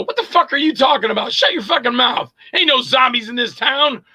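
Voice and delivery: acted exasperated outburst; angry comeback. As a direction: snap at your co-star in disbelief. Acts angry comeback from film scene.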